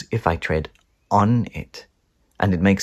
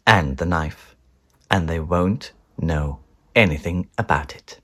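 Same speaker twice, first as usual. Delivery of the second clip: plain reading voice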